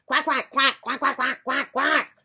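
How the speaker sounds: high, loud rapid quacks; noisy little duck voices; quacking ducklings. Acted. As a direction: quack like a brood of excited ducklings. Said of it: "louder as their quacks could quack".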